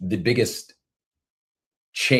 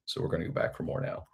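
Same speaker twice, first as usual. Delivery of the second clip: soft, unvoiced muttered aside; whispered aside; 2 semitones lower, 9 dB quieter, faster than usual. Real, not acted. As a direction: drop to a whisper, off-mic. Aside while fetching the ginger.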